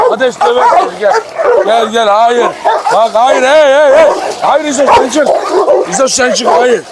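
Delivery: yelling at fighting dogs to stop; stopping a dog fight. Real, not acted.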